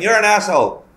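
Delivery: a high, relished "You're an asshole"; gleeful insult. Retold or imitated. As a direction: savour every word of the insult. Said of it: "it felt good saying".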